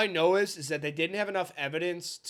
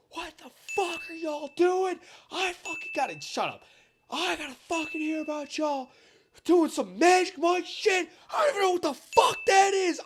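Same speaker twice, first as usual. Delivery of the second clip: high, loud furious drill instructor voice; imitated angry yell; 13 semitones higher, 4 dB louder than usual. Retelling the instructor yelling at recruits, high furious shout. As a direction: bark it out like an enraged instructor. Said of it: "He's like".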